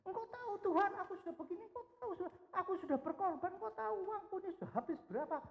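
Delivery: very high, whining, rushed self-pity; whiny complaining prayer. Retold or imitated. A believer listing sacrifices to God in prayer, whining high. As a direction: whine at God like a martyr. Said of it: "wah, banyak ngomongnya".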